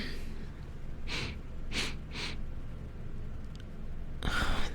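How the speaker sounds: muffled coughing fit; coughing at stench. Acted. Repairer opens a bad-smelling head plate, coughing fit. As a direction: cough repeatedly at a foul smell. Acted reaction to a foul smell.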